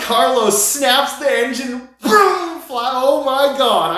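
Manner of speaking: reliving the rush, bursting out; real excitement. Real, not acted. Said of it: "I got goosebumps telling the story".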